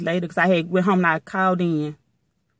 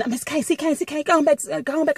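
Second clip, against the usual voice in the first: higher-pitched voice of an intervening supervisor; imitated supervisor; 6 semitones higher than usual. Other supervisor tells her to go on back, imitated supervisor.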